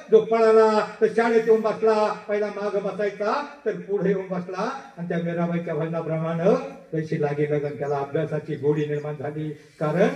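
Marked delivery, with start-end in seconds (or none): none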